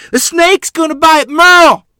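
high, loud panicked shriek; panicked scream. Acted. Mother sees the snake by the baby, shrieking in panic. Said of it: "Mother scream in panic".

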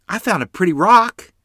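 put-on a small girl showing off her find; little sister's voice. A narrator's reading. Lottie shows her brother a treasure, excited child voice.

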